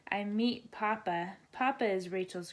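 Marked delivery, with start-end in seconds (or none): none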